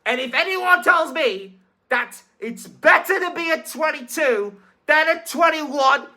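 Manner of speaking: ordinary delivery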